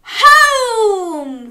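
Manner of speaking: high, loud, long cheer; jubilant cheer. Acted. Crab arrives home, cheering loudly. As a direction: cheer it out at full stretch. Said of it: "cheered Crab".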